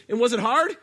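loud high challenging question. Retold or imitated. Jillian loudly quizzing the contestant. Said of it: "No, she goes".

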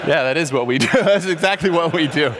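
chuckling through the words; laughing admission. Retold or imitated. The speaker admits the point to the audience, laughing as he speaks. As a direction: laugh as you concede it. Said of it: "And I thought".

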